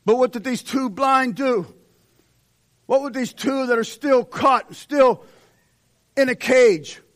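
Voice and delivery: fiery revival preaching; preaching at full force. Acted. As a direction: drive the text home at the crowd.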